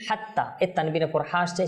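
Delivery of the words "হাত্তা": usual speaking voice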